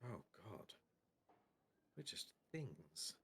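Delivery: hushed dawning realisation that you are only an object; bleakly murmured existential dread; existential despair. Acted. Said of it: "they're both like".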